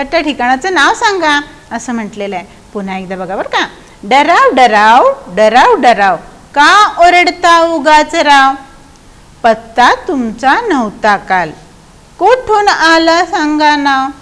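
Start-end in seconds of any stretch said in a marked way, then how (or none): 4.0-14.1 slow, stretched verse, loud at first; poem recitation. Shown as a demonstration. Teacher reciting the verse once more for pupils, slow recitation. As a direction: recite in drawn-out beats, strong opening.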